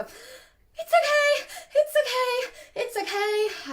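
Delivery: high, loud panicky squeal; startling high-pitched mantra, full of alarm; panicked reassurance. Shown as a demonstration. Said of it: "can you feel the panic going through my voice as I do that rapid talking".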